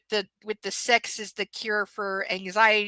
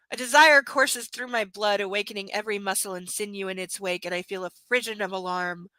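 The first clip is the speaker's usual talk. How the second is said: ordinary delivery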